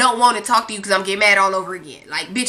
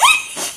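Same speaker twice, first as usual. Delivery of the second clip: sudden squealing laugh; high-pitched laugh; high burst of laughter. Real, not acted. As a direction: break into a high giggle. Laughing at her own reaction.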